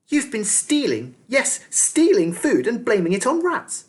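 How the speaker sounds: put-on high, sharp accusing girl; snapping. A narrator's reading. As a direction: snap the accusation at him. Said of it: "snapped Militia".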